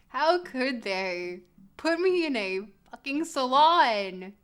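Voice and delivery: mock angry. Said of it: "because I am angry".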